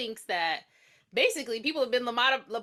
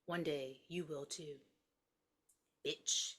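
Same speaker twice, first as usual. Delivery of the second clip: smug calm; 4 semitones lower, 10 dB quieter than usual. Retold or imitated. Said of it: "And he's like".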